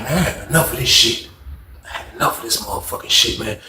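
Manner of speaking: low, soft exasperated grumble; fed up. Retold or imitated. Man done with his cellmate's antics, low fed-up muttering. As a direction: say it under your breath, deep and tired.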